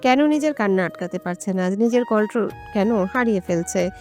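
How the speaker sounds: usual speaking voice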